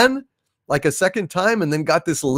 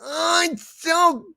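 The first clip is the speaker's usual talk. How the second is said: high, slow mock-crying complaint; high whiny outrage; 13 semitones higher, much slower than usual. Streamer voicing a character wailing over the fish joke, high mock cry. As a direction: whine like it wounded you.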